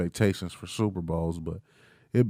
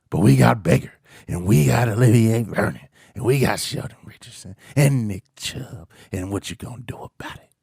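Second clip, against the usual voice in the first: taunting. Real, not acted. Taunting a Steelers fan friend.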